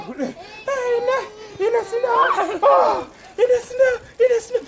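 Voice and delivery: real high laughing shout; excited shout. Real, not acted.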